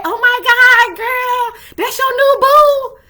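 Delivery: very high, loud squeal of delight; squealing girlfriend. Retold or imitated. Friends hyping her new boo, squealing high and loud.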